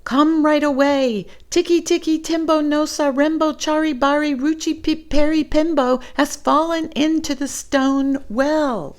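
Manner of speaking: put-on high, loud, fast calling boy; shouting. Chang shouting to the old man, high, loud and fast. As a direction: yell the whole name in one rush. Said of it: "shouted Chang".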